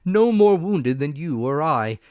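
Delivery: loud, dismissive doctor; brusque doctor. A narrator's reading. Doctor dismisses the worry to mother, loud and brisk. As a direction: scoff it off firmly.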